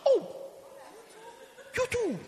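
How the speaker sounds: high, loud mock astonishment; exaggerated high-pitched surprise; astonished. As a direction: act amazed at the person beside you.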